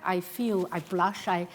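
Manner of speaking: ordinary talk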